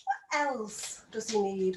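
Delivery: ordinary talk, nothing marked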